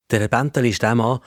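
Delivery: ordinary delivery, nothing marked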